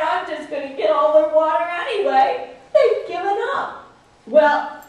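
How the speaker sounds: high, loud gleeful trickster voice; sly trickster. Acted. Shulo gloating alone at the well, high and sly. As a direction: gloat like a sly rabbit who thinks he's won.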